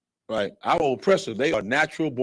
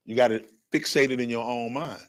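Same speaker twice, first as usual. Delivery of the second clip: ordinary talk, nothing marked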